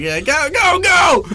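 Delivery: high, loud mock shout; frantic urging. Boy running to mom's car, shouting go. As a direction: yell for a fast getaway. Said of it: "and, like".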